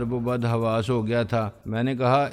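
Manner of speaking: ordinary delivery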